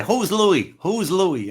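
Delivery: loud, high-pitched repeated question; exaggerated high, loud query; comic calling out. Acting out the running gag.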